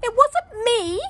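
put-on high, loud child voice; girl's protest. A narrator's reading. Daughter denying it to her parents. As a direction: insist like a little girl. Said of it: "said Lara".

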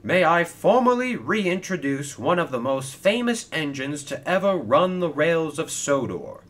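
loud, high ceremonial introduction, stretched out; grand announcer. Acted. Sir Topham Hatt presents Stephen to the crowd, grandly announcing. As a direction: proclaim it to the whole crowd.

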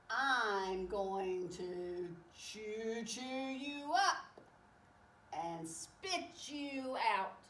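slow, drawn-out cartoon shark threat; menacing shark. Shark threatens the train, slow drawn-out menace. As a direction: draw out each word as a playful threat.

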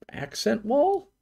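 ACCENT WALL lifted high into an unsure question. Real, not acted. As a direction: say "accent wall" as a doubtful guess, pitch rising. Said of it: "Question mark?".

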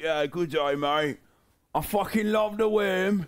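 put-on British accent for a frog. Guest voicing what the bullfrog would say, mock British accent. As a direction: speak as a matey British bullfrog. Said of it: "like, a British accent".

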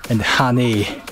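ordinary talk

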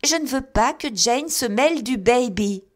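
loud, high imperious decree; mother lays down the law to her family, loud and imperious; majestic scolding. A narrator's reading. Mother decreeing to the family, loud and majestic. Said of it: "avec un regard majestueux".

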